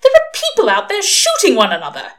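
high, loud outrage; horrified scolding. A narrator's reading. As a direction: cry out in alarmed reproach. Angel scolds Crowley in quarrel.

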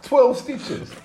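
incredulous laughing outburst. Real, not acted. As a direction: blurt it out amazed through laughter. Laughing disbelief at injury.

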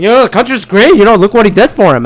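a fan brushing off every doubt; mock Trump supporter. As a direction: ham up a proud supporter, quick and loud.

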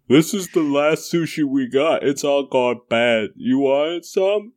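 slow, odd put-on shopkeeper voice; bodega owner character. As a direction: draw it out, pitching up for the offer. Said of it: "who runs a bodega".

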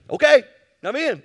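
high, very loud burst of eagerness; eager yes. Pretend. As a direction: jump in with full enthusiasm. Eager answer to Jesus.

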